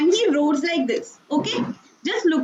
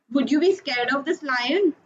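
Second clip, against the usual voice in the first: usual speaking voice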